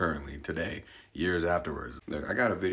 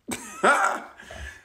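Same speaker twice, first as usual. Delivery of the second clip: high airy laugh; high burst of laughter. Real, not acted. Laugh after tease.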